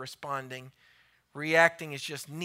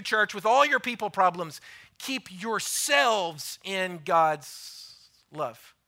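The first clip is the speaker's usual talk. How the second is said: ordinary talk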